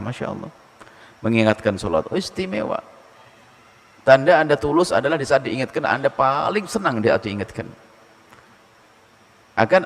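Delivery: ordinary delivery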